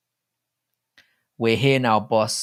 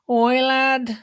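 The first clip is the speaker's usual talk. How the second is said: put-on northern lad accent; 5 dB louder than usual. Mock northern lad call in accent chat.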